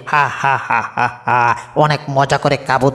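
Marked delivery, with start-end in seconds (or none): 0.1-1.4 low, gloating cartoon villain chuckle; smug evil laugh. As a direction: chuckle like a greedy lion. Lion gloats over the chicks.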